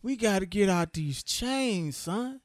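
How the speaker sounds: drawn-out, hammed-up Southern slave impression; slow mock-Southern drawl, played for laughs; bad Southern accent. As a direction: mimic a badly acted Southern accent. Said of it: "the worst Southern accent".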